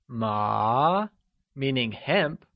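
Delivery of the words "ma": "ma" drawn out, gliding upward; mid-rising tone. Shown as a demonstration. Teacher gives the answer meaning hemp, "ma" in rising tone. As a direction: start "ma" low and climb. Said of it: "second or the mid-rising tone".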